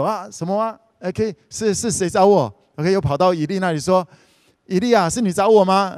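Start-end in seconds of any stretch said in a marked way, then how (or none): 4.7-5.9 put-on higher, louder boyish question; a boy pressing his master a third time; insistent boy. Boy Samuel asks Eli the third time, higher and louder.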